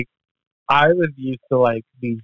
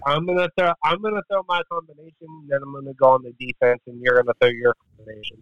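mock kickboxer plan; 6 dB quieter, faster than usual. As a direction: rattle it off like a rehearsed routine. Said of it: "he's like".

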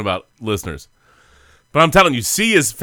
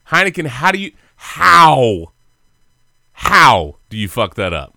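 exasperated; 5 dB louder, slower than usual. Real, not acted. Exasperated rant about Heineken.

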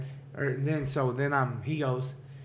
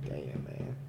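hushed under-breath reaction; 13 semitones lower, 12 dB quieter than usual. Retold or imitated. Reacting to the mother's death story.

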